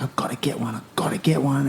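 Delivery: hushed, half-whispered urging; urgent inner voice. Retold or imitated. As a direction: mutter it low to yourself. Voicing a selfish surfer's inner urge.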